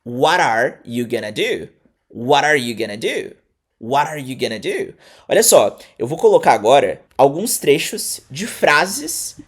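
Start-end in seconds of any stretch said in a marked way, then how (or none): 0.0-4.9 "What are" blended as "wara", "going to" shrunk to "gonna"; low repeat-after-me "What are you gonna do?"; reduced pronunciation drill. Shown as a demonstration. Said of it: "Pode repetir".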